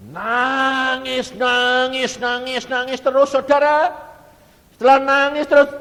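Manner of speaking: high, very loud, stretched repeated cries; impassioned preaching shout. Real, not acted. Preacher tells congregation of endless weeping, shouting fervently. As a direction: belt out each repetition at full force.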